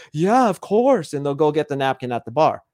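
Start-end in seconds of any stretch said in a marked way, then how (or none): 0.1-1.1 high, slow, gushing agreement with a chuckle; eager to please. Acted. Guy agreeing to a woman's favor, eager to please. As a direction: imitate a smitten pushover, pitched up.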